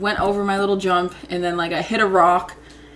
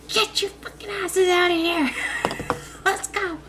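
mom yelling; 11 semitones higher, 4 dB quieter than usual. Retold or imitated. Mom finds kids hiding.

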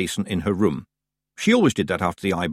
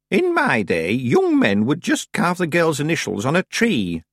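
put-on old witch voice; 6 semitones higher than usual. A narrator's reading. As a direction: speak as a stiff old witch. Old witch Miss Treason speaks.

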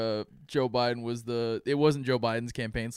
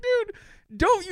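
squeaky high disbelief; high mock outrage; 5 dB louder than usual. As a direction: yelp it up high. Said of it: "and I was like".

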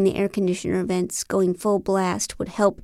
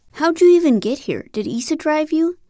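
surprised; 7 semitones higher, 4 dB louder than usual. Acted. John surprised to see her.

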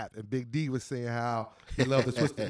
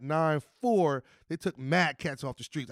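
normal talking voice

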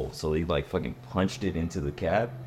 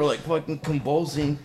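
ordinary talk

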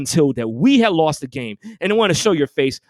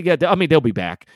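normal talking voice

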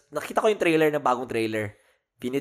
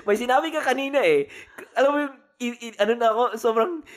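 much higher, processed, auto-tune sound; auto-tuned voice; 4 dB louder, faster than usual. Real, not acted. Host answers co-host's auto-tune question through processed, much higher voice. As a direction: let the voice sound pitched up by a machine. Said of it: "parang medyo auto-tunes".

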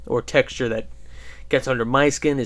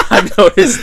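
laughing; 9 semitones higher, 8 dB louder than usual. Pretend. Mocking high laughing repeat.

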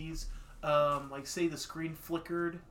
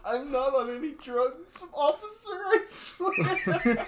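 high, loud, laughing mock plea; mock pleading suspect; 12 semitones higher, 7 dB louder, slower than usual. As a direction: plead innocence to a cop, giggling.

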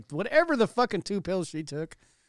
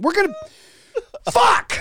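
higher, louder burst of fake frustration; exasperated outburst; 7 dB louder than usual. Mocking politicians who run out of threats, loud exasperated curse. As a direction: blurt the curse as the threat falls apart.

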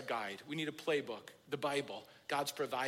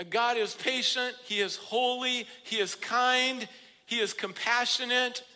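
raised, higher pulpit voice; fervent preaching; 5 semitones higher, 7 dB louder than usual. Real, not acted. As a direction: build a ringing list of praise. Preacher extols God in a list.

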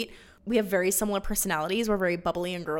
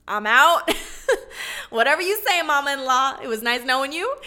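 high, loud, laughing sass as Orpah; sassy brush-off; 4 semitones higher, 6 dB louder than usual. Acted. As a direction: toss the mother-in-law off breezily and cheekily. Orpah voiced leaving Naomi.

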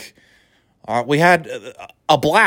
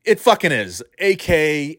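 ordinary talk

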